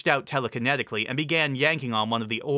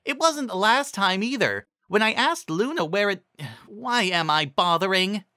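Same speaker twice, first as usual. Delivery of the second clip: high, exasperated pony voice; Trixie's voice; 9 semitones higher than usual. A narrator's reading. Narrator voicing Trixie giving up with a sigh, high. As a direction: trail off with a sigh, pitched up.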